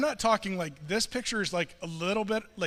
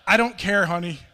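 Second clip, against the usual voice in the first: loud mock-exasperated dad; retold exasperated outburst; 11 dB louder than usual. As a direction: blurt it out loudly, fed up. Exasperated dad cuts off daughter.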